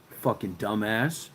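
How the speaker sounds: ordinary delivery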